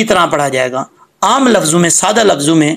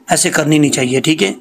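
ordinary delivery

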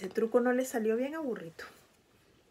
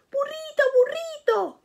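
much higher, louder call of the name; excited calling out; 3 dB louder than usual. Acted. Jackie calls out to his friend Burrito, high excited shout. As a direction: shout the name across the party. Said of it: "grita Jackie".

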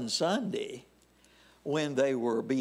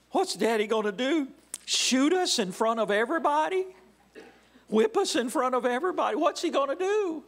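put-on higher, panicky kids' thoughts; mock frightened boys; 9 semitones higher, 3 dB louder, faster than usual. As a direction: act out the boys' panic, a bit higher and louder. Voicing petrified boys' thoughts.

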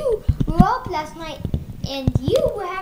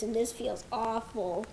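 ordinary talk

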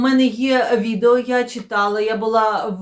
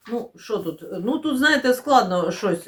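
ordinary delivery

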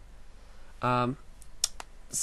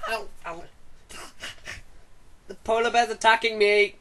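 higher and louder mock panic; mock panicked shout; 11 semitones higher, 5 dB louder than usual. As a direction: yell as if under attack. Mock attack by toy bear.

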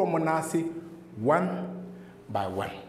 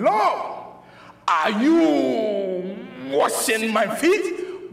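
Peter's astonished question; much slower than usual. Acted. Preacher voicing Peter to Jesus over foot washing, drawn-out question. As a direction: stretch out the words in mock disbelief. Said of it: "And Peter said to him".

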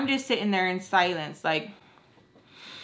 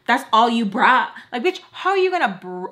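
indignant retort; 2 semitones higher, 7 dB louder than usual. Retold or imitated. Answering a friend who judged her money, indignant. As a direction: fire back in quick, loud disbelief.

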